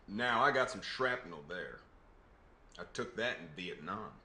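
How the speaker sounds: a Southern vet telling his scars; quiet Southern twang; Southern accent. Acted. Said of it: "putting on a Southern accent".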